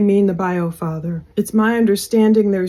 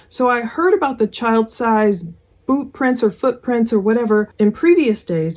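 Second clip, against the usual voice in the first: ordinary delivery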